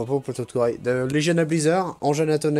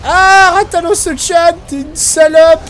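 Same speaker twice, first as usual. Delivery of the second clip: high, loud hammed-up anime fan; excited anime fan; 11 semitones higher, 7 dB louder, much slower than usual. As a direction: gush like an overexcited otaku. Mock anime address.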